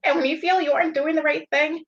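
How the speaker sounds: real emotion, high and halting; choked up. Real, not acted. Guest sharing her faith after apologizing, emotional and choked up. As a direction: hold back tears as you testify. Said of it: "I'm sorry, I'm getting emotional".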